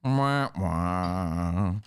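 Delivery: low, soft sigh into sad-trombone wah-wahs; mock deflated. Prank victim learning they never heard of him, mock deflated. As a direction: sink into a comic letdown.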